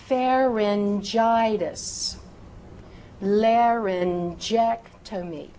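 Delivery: slow, drawn-out syllables for students to repeat; very precise enunciation. Shown as a demonstration. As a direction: model each word with exaggerated care. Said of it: "I am being very precise".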